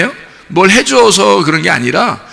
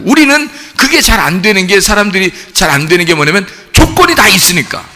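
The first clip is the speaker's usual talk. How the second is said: ordinary delivery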